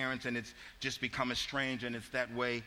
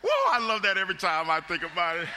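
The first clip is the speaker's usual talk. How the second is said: louder and higher, still laughing; delighted, still laughing; 6 semitones higher, 8 dB louder than usual. Real, not acted. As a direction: talk through the tail of a big laugh. Laughing after joke.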